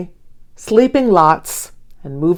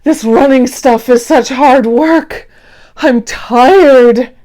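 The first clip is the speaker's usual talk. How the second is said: louder, worn-out grumble of a tired sloth; tired Hank complaining; 4 semitones higher, 6 dB louder than usual. Acted. Hank complains to Dee during training, worn out and whiny. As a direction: moan that training is too hard. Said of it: "Hank says to Dee".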